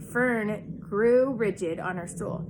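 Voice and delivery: ordinary delivery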